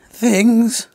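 sudden high squeaky crack. Real, not acted. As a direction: let the word break upward like puberty. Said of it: "went through puberty there".

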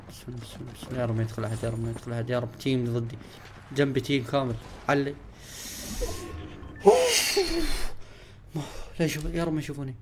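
soft, tense murmuring to himself; hushed nervous prayer. Real, not acted. Gamer hiding in a building, praying softly. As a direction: beg quietly that nobody walks in.

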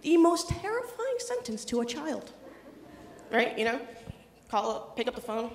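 ordinary talk, nothing marked

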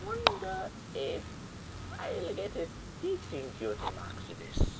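odd character voice. Acted. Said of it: "What happened to her voice?".

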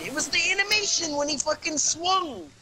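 high, loud indignant yell; angry protest. Real, not acted. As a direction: shout back in frustration. Heated protest.

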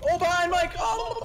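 high, loud alarmed shout; warning yell. Real, not acted. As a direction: cry out to warn them. Enemy behind teammate.

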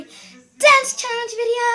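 loud, high, drawn-out title shout; excited shout. Real, not acted. Vlogger kicking off her dance challenge video, excited shout. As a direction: belt out the video title like a hype intro.

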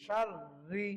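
ordinary talk, nothing marked

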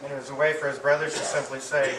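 ordinary talk

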